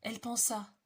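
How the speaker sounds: usual speaking voice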